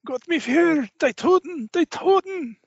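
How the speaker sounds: high, loud desperate babble; frantic German pleading. Acted. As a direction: rave in terror about the dead. GM voices struggling German officer.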